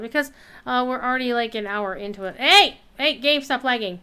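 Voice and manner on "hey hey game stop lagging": loud, irritated hey at the screen; annoyed yell. Real, not acted. Gamer yelling at the lagging game, annoyed.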